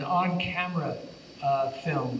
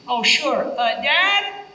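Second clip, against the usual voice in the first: put-on high, louder child calling for dad; kid voice; 11 semitones higher, 6 dB louder, much slower than usual. Actor fooling the director on the phone, high child voice. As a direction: pretend to be the son fetching Dad. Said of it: "I said".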